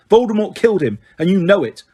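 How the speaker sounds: Harry furious at Umbridge; angry. A narrator's reading. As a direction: throw it in her face. Harry accusing teacher.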